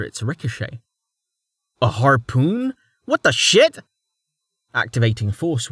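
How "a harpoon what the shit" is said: shocked. Acted. Rain shocked.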